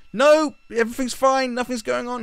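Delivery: high-pitched, frantic denial; hammed-up propagandist in denial; mock regime spokesman. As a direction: squeak out a comically false reassurance. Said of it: "the guy who's like the head of communications".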